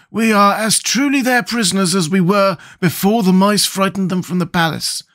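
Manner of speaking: high, emphatic character voice; high-pitched storybook Scarecrow; resigned Scarecrow. Acted. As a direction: state the plight in a high scarecrow voice.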